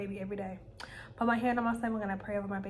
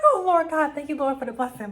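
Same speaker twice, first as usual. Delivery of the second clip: excited praise; 4 semitones higher, 9 dB louder than usual. Retold or imitated. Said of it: "I'm like".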